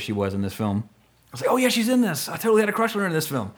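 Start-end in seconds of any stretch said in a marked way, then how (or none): 1.5-3.5 high-pitched, giddy self-quote; gushing recollection. Retold or imitated. Recalling his crush on the actress, high and gushing. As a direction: gush it in a raised voice. Quoting his own excited reaction.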